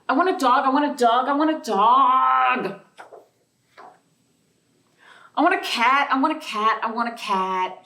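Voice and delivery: put-on demanding little girl. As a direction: nag like a little girl who wants a pet. Child character whining demands.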